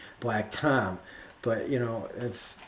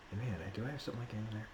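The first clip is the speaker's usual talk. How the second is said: fast, much softer self-talk; rushed, much softer muttering; muttered aside; 3 semitones lower, 18 dB quieter, much faster than usual. Real, not acted. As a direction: mumble it to yourself while checking notes. Muttering to himself over notes.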